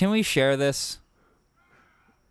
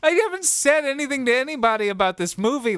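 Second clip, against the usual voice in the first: high, fast and disgusted; rattled and disgusted, pitched up; grossed out; 9 semitones higher, faster than usual. Real, not acted. Reacting to the movie in disgust.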